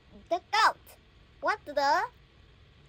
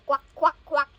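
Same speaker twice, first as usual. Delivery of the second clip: louder playful quack; quacking duck; 3 semitones lower, 6 dB louder than usual. Pretend. Child reading a picture book, quacking for the duck. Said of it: "Duck say".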